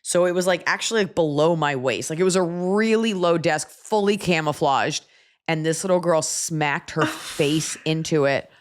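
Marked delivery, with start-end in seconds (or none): none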